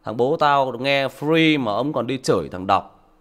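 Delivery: loud, slow scornful jeer; mocking child. Son mocking his father, loud scornful sneer. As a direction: sneer at the father like a grown kid. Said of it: "nó bảo".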